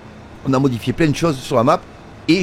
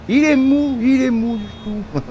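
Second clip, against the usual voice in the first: much higher, slower, hammed-up mock whine about the worker; mocking a lazy worker; 8 semitones higher, slower than usual. Teasing the slow AI farmhand, high mocking drawl. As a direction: ham it up, sneering at a slowpoke.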